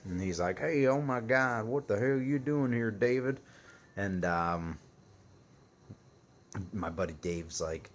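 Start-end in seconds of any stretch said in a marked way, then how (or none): 0.6-3.4 country dude greeting. Retold or imitated. Shop owner greeting Dave as he walks in, hearty country voice. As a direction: boom out a surprised hello like a good old boy. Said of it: "this big old country fed dude".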